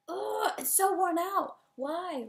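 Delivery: loud, high, drawn-out groan of complaint; exasperated. Real, not acted. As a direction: let real exasperation burst out, slow and loud. Ranting about an overused trope.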